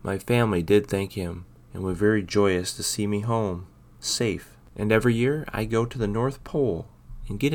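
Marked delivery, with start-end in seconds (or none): none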